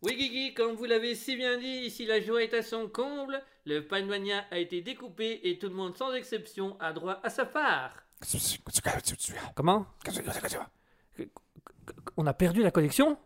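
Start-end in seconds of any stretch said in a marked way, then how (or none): 0.0-7.9 field reporter voice. Acted. Reporter on a remote line.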